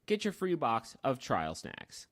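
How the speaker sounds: usual speaking voice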